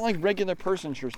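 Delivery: usual speaking voice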